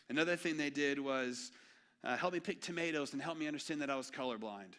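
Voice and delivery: ordinary delivery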